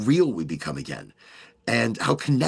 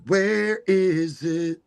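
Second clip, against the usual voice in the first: higher and slow, sing-song; drawn-out mock search; 6 semitones higher, much slower than usual. Host hunting the fairgrounds on a map, sing-song. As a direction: stretch it like a puzzled game.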